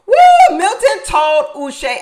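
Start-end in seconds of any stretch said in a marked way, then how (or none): 0.1-0.6 a high, loud laughing whoop. Real, not acted. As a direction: let out a delighted cackle and whoop. Excited reaction to the show.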